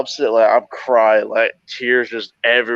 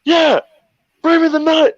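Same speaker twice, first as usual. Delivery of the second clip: teasing call. Retold or imitated. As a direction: bark it, teasing. Teasing reply over radio.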